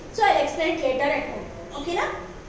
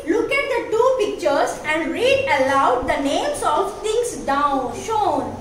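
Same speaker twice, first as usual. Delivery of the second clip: ordinary delivery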